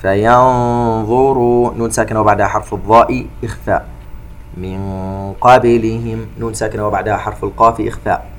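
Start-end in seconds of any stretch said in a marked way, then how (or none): 0.0-1.7 slow chanted tajweed recitation with echoing qāf; Quran recitation. Shown as a demonstration. 4.6-6.3 slow chanted tajweed recitation with echoing qāf; Quran recitation. Shown as a demonstration.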